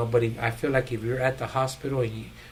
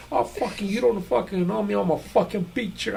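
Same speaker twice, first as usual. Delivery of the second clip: higher, put-on old man threatening a fight; voicing a cranky old man; 8 semitones higher than usual. Old roommate threatening the narrator, a put-on cranky voice. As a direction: play the cranky old roommate, half-laughing.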